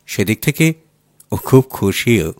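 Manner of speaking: ordinary delivery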